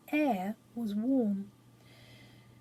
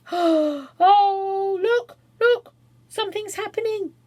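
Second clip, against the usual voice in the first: high, loud, thrilled shout; excited cry; 9 semitones higher, 9 dB louder, slower than usual. A narrator's reading. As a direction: call out in sheer excitement. Children see the egg crack.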